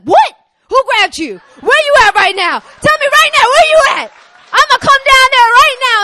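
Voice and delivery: very high, loud protective yelling; irate husband, acted. Husband hearing that a guy grabbed his wife, yelling in alarm. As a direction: demand answers at full volume, hammed-up outrage.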